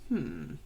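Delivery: deep, low imitated groan of the dog; low dog moan.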